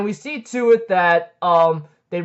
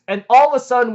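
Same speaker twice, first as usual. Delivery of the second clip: ordinary delivery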